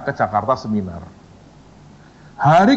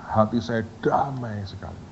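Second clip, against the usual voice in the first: whisper; 3 semitones lower, 9 dB quieter than usual. Retold or imitated. Whispering about his inner peace.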